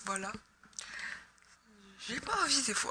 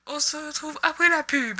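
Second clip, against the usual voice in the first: mock TV host; 3 semitones higher, 7 dB louder than usual. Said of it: "Non, c'est une blague. Je ne suis pas dans un show télévisé".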